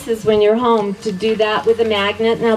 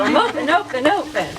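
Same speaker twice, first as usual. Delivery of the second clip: much higher, bouncy ad-style chant; commercial jingle voice; 7 semitones higher than usual. Retold or imitated. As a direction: sing it like a TV commercial. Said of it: "just like the commercial".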